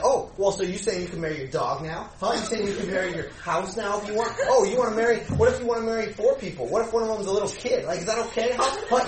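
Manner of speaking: mock retort, hammed-up and loud; mocking jab. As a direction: needle them with loud, high sarcastic questions. Mocking retort in retold argument.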